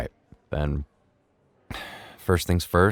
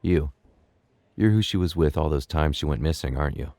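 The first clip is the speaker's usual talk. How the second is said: gentle father; 7 semitones lower, 5 dB quieter than usual. Acted. Father gently questions daughter's friends.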